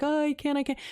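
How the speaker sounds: high, whiny little protest; mock pleading. Imitating crying girl told to stand, mock-helpless. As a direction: imitate a tearful refusal, lightly. Acts her crying plea to him.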